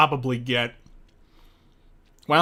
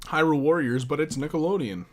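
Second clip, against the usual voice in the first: ordinary delivery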